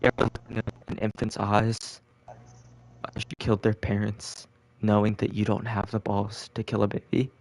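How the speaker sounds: mock CIA recruiter grilling a new hire, dropping to a chilling hush; menacing recruiter.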